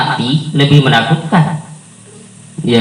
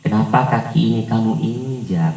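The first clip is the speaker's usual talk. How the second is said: softer, mild Solo Javanese complaint; polite Javanese hiding real anger; gentle Javanese manner; 7 dB quieter than usual. Shown as a demonstration. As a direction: keep it sweet though your foot was stepped on. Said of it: "karena sudah logat seperti itu".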